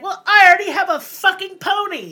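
put-on loud, high smug teen; defiant. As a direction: rub it in their faces.

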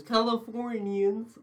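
high, drawn-out Californian accent; mock Californian accent. Shown as a demonstration. Friend showing the only accent he has, mock Californian. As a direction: stretch it slowly into a Californian lilt. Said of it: "that's all I can do".

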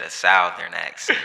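laughing, loud "Southern" with an f for th; mimicked Southern pronunciation. Teasing friend about his accent, "Southern" mimicked with an f. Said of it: "The South with an F".

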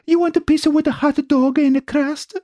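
high, halting cartoon Italian; squeaky-high exaggerated Italian accent; hammed-up Italian accent. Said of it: "Do you like my Italian accent?".

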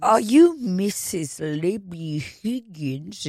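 slow, put-on posh accent; fake posh accent. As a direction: drawl the question like a fancy server. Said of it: "Dude, you're doing a fucking accent".

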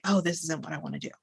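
soft quick realization. Retold or imitated. Person noticing their own outburst, quietly quick. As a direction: murmur it fast as the words land.

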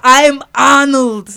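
hammed-up Arnold Austrian boast; Arnold impression. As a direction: drag out I'm Arnold in a big Austrian accent. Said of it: "Now you're doing an Arnold impression".

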